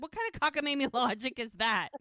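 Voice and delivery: high, loud mock outrage breaking into a laugh; hammed-up exasperation, amused underneath; indignant retort. The ladies challenging Muriel's logic, mock indignant. Retold retort, begun over.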